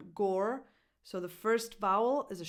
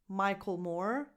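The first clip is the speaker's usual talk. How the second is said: ordinary delivery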